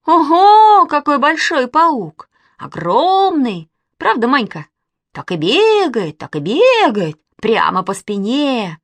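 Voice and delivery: loud, drawn-out mock wonder; big, gleeful exclaiming; teasing amazement. A narrator's reading. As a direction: exaggerate to scare the boy. Girl teases boy about spider.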